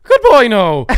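mock shout. Acted exit line from room.

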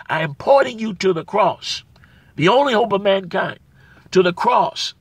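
loud, rising preacher's cry on Jesus Christ; preaching with fire. Acted. Pastor exhorting his brothers and sisters, loud preaching. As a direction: proclaim it to the back row.

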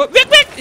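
high, loud squeaky "vik vik" cry; mock squeak. Player mimicking a creature, high loud squeak. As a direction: squeak it out like a little animal.

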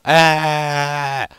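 sudden high, loud yelp of alarm; a sharp shriek, caught off guard; startled scream. Real, not acted. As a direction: scream out as you drop. Game character falls suddenly.

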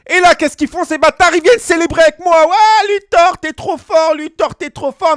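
voix très haute, emballée; excité. Retold or imitated. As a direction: jouer les coéquipiers qui exultent. Coéquipiers célèbrent, voix montée. (coéquipiers en liesse, voix aiguë et excitée)

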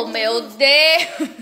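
slow, laughing "Ô, meu Deus"; slow amused laugh at the grumpy dog; laughing exclamation. Real, not acted.

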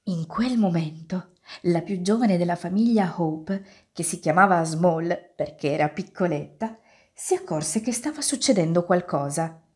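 usual speaking voice

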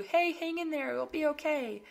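high, sing-song pep talk; chirpy empty reassurance. Acted. Speaker mimics what she won't tell strugglers, in high mock cheer. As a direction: put on a high, breezy consoling voice. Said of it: "I don't want to say to you".

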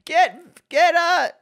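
high loud burst; excited. Real, not acted. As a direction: blurt it out, excited. Excited calling.

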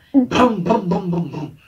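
loud burst of motor noises; imitated engine revving. Engine passing across the football field, imitated revving. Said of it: "um trem acelerando".

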